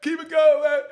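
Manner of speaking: very high, very loud, stretched holler; yelled egging-on. Retold or imitated. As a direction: whip up the fight with a piercing yell. Egging on the fight, yelling.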